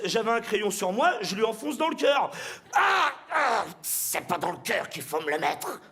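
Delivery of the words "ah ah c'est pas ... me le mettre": pained cry. Acted. Vampire stabbed with a pencil.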